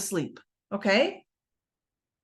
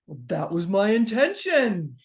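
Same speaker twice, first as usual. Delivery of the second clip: slow, deliberate Oprah impression, lower pitched; slow lower Oprah voice accepting the thanks; 3 semitones lower, slower than usual. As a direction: channel Oprah's measured, gracious calm. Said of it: "that's [name]'s Oprah voice".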